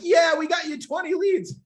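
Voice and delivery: loud, high whooping cheer; mock celebration. Coach mimicking clients celebrating twenty leads, mock cheering shout. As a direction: shout it like an over-the-top victory. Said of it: "We're not there celebrating like".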